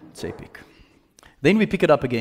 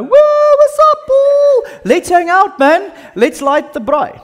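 very high, loud, delighted welcome; excited greeting; 10 dB louder than usual. Retold or imitated. Ephesus friends greeting Paul, loud and delighted. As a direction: whoop it up like old friends. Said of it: "everyone's gonna go".

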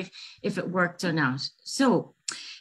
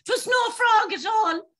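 high, slow triumphant calling out; mocking cry out; 13 semitones higher than usual. Leprechaun calling back after tricking the girl, slow high mocking cry. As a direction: crow it out slowly from afar. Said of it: "I heard him cry out".

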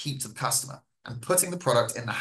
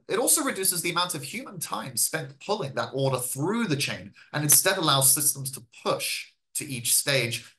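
ordinary talk

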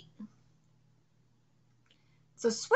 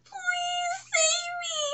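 high, whispered pleading; hammed-up begging villain; terrified villain; 13 semitones higher than usual. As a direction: beg for your life. Villain begs Yomogi.

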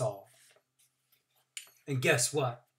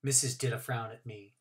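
usual speaking voice

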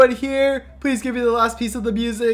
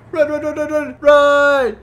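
rising to a shout of run; urgent shouting; 6 semitones higher, 5 dB louder than usual. Acted. Fleeing the fangirl, he shouts for everyone to run. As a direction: yell at everyone to flee, louder each time.

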